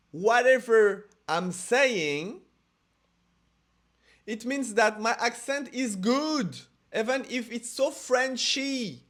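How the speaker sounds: slow, loud English with a French accent. Shown as a demonstration. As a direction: speak English slowly in a thick French accent. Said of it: "parler avec l'accent français".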